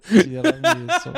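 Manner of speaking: very high, slow mock Italian; squeaky-high Italian caricature; Italian accent. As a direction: stretch it out in a cartoon Italian accent. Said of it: "faire mon petit accent italien aussi".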